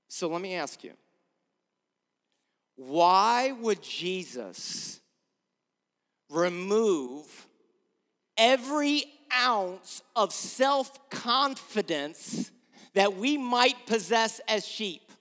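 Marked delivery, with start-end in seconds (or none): 2.8-14.4 "why would Jesus remove" drawn out slowly, each word set apart; measured "why would Jesus remove"; slow and deliberate. Real, not acted. Rhetorical question, then repeated.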